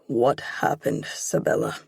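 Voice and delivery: lightly voiced strict dad; hard father's voice. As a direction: press her for the truth. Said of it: "My dad's voice is hard".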